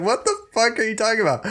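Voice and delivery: high, fast incredulous outburst; baffled stranger. Retold or imitated. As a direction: play someone totally confused. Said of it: "They'll be like".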